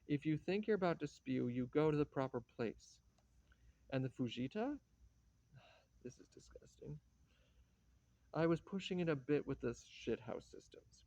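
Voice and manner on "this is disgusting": whispered under the breath in disgust. Acted. Whispering to her husband about the vomit, disgusted. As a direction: hiss it privately so they won't hear. Muttered aside about the mess.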